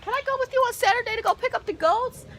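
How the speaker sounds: put-on a boy begging to come along; eager kid. Son Joseph asking to fetch the goats, eager and fast. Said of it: "Joseph was all like".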